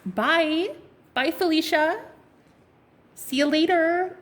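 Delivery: high, sing-out dismissive goodbye; sassy farewell. Acted. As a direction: wave them off with relish. Acted sassy farewell to doubters.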